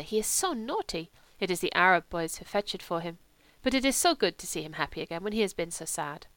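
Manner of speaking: put-on gentle monk with foreign accent; confiding lay brother. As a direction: play a fond foreign monk telling tales.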